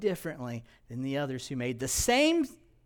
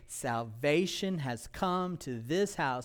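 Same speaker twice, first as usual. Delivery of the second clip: slow solemn; 3 semitones lower, 4 dB quieter, slower than usual. Acted.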